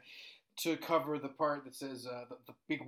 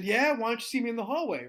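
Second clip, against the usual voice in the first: loud, higher mock-tough challenge; trying to sound tough; 8 semitones higher, 5 dB louder than usual. Young teacher summons student to hallway, trying to sound tough. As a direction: act tough, pushing the voice up. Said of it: "I wanted to sound tough at the time".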